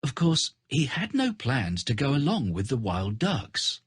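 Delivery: ordinary delivery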